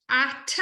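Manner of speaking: "at" said as a full, careful syllable; mechanical "at". Shown as a demonstration. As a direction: sound out "at" by the book. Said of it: "most correctly or sort of mechanically".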